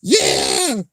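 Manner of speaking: high, shouted character excitement; so excited. Acted. Character who heard a voice, acted excitement. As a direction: act out a thrilled high-pitched cheer. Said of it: "he's so excited".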